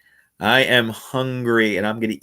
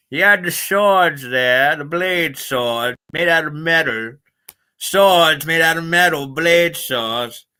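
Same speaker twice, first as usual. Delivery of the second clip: exaggerated, slow-drawling character; put-on cartoon voice; 5 semitones higher, much slower than usual. Streamer recalling an old TV show, in a silly character voice.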